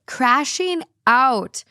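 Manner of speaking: slow dramatic. Acted. Slow dramatic theme phrase.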